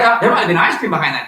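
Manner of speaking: normal talking voice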